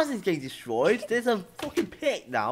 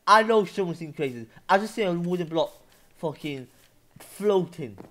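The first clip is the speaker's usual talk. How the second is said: ordinary talk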